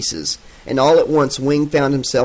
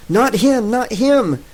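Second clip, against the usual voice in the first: higher, urgent yell to stop her; 9 semitones higher, slower than usual. A narrator's reading. Lynn yells at the soldier choking Fredo, higher and pleading. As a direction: cry out to save him. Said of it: "Lynn yelled".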